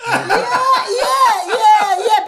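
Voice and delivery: high, loud laughing yeahs; real laughter through the yeahs. Real, not acted. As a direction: laugh the words out. Bursting out laughing at story.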